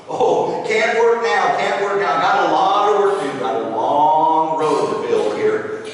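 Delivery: loud, hurried, brushing him off; too busy to look up; busy worker brother. Acted. Busy brother building road.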